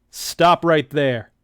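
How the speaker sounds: loud, slow commanding "Stop right there"; a first try at the hero's command. Acted. Sergeant ordering the thugs to stop, loud and slow. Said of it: "let me get that voice right".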